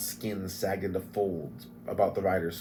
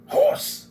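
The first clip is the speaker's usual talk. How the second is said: high hissed sneeze-shout; sneezing dwarf; 9 dB louder than usual. Acted. Flint sneezing from the horse, hissed. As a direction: blurt it out in a sneeze.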